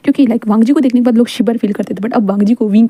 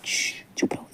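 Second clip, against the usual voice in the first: whispered shush, be quiet; soft whispered hush; 16 dB quieter than usual. Acted. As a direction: hiss him into silence. Hushing Wang.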